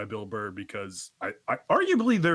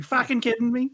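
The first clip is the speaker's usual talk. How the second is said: high, loud Boston snap; Bill Burr impression; 12 semitones higher, 6 dB louder than usual. Cohost correcting the Bill Burr impression, loud Boston snap. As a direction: bark it in a Boston accent. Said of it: "it's more of a".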